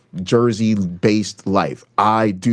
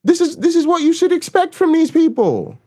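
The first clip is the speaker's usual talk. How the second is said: exasperated high mock yell. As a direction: shriek at them like a fed-up liberal.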